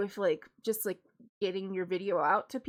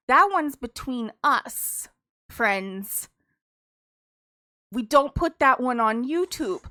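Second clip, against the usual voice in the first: emphatic; 9 dB louder, slower than usual. Real, not acted. Addressing viewers emphatically.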